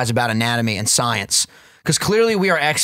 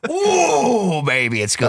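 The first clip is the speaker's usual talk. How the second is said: savoring; 6 semitones higher, much slower than usual. Pretend. Savoring snack memory.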